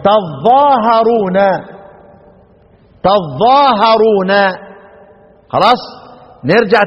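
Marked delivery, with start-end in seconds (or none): 0.0-1.4 "تظاهرون" as taẓ-ẓaa-ha-ruu-na, very slow; Ibn Amir's reading. Shown as a demonstration. Teacher answering how Ibn Amir reads "تظاهرون", slow taẓ-ẓaa-ha-ruu-na. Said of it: "يبقى ابن عامر يقرأ ايه؟". 3.0-4.3 "تظاهرون" as taẓ-ẓaa-ha-ruu-na, very slow; Ibn Amir's reading. Shown as a demonstration. Teacher answering how Ibn Amir reads "تظاهرون", slow taẓ-ẓaa-ha-ruu-na. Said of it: "يبقى ابن عامر يقرأ ايه؟".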